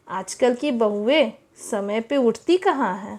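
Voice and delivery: ordinary talk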